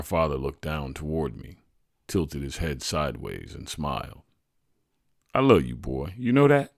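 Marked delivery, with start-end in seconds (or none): 5.3-6.7 bright, rising, a bit louder; celebrational tone. A narrator's reading. Father telling his son he loves him, celebrational. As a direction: celebrate the words as you say them. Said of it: "in an almost celebrational tone".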